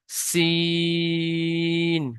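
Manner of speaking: সিন with a thin hissing s, drawn very long; thin long sin. Shown as a demonstration. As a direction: hiss সিন like a pressure cooker whistle. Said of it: "সিনের আওয়াজটা হবে একটু পাতলা আওয়াজ". (পাতলা আওয়াজে লম্বা টেনে সিন)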